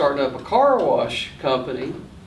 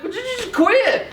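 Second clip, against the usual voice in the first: much higher, laughing fed-up plea; exasperated plea. Retold or imitated. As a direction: beg the kid to stop, half laughing. Exasperated dad with pestering daughter.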